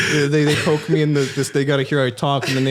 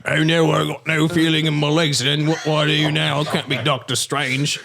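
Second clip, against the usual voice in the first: hammed-up British lament; broad mock British accent, slowing at the end; Benedict Cumberbatch; 3 semitones higher than usual. Waking up in hospital, hammed-up British dismay. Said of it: "Your accent of Benedict Cumberbatch".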